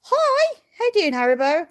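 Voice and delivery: pet talk. Real, not acted. Greeting a sheep.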